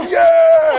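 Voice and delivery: loud high whoop of agreement; hyped yell. Retold or imitated. Drunk at the bar whooping yes to his friends, loud. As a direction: whoop it up like the whole bar's with you. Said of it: "And you go".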